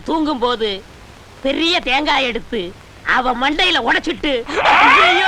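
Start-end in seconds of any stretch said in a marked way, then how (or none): 4.7-5.3 high, loud hammed-up cry of alarm; mock wail. Disciple acts out the lie he'll tell the village, mock wail. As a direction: fake the villagers' shocked wail. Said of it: "அப்படின்னு ஊருக்குள்ள கதை கட்டிட".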